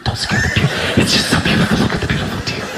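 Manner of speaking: hushed, reverent mock whisper; mocking gushy nature-lover whisper; awestruck whisper. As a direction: whisper in exaggerated wonder so the deer won't startle. Everybody mimicked marvelling at a deer.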